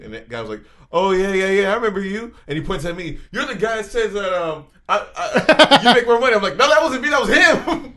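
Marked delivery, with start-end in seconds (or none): 0.9-2.3 higher, louder eager recognition; mock collector. Imitating the collector recognizing them. 3.3-8.0 much higher and louder, laughing through the quote; cracking up while telling it. Real, not acted. Laughing through retold exchange.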